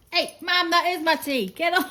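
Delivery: high, loud cheeky puppy voice; puppy's squeaky protest, breaking into a laugh. Pretend. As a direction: voice the puppy scolding mum over her tea.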